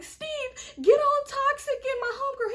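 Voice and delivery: high frantic yell; shouting. Retold or imitated. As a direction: call out urgently for help. Shouting for help during shooting.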